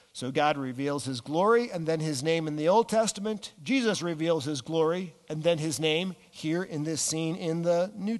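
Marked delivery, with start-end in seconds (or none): none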